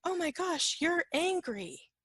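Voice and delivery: alarmed companion. Acted. Companion suddenly notices your anger, high alarmed mimicry. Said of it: "the person with you goes".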